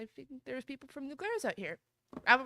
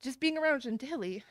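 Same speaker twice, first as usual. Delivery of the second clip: ordinary talk, nothing marked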